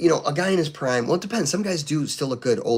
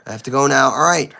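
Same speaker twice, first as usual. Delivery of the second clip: usual speaking voice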